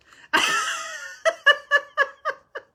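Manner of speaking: shrieking high laughter, near tears; loud high-pitched helpless laughing; hysterical laughter. Real, not acted. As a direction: laugh until you almost cry. Laughing hard at Rick.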